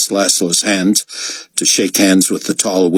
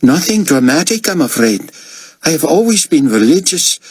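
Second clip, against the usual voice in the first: Albi's voice; 9 semitones higher than usual. Acted. Albi tells of his faith.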